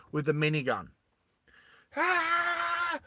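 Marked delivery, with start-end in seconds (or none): 2.0-3.0 high battle yell. Acted. Battle cry in retold fight.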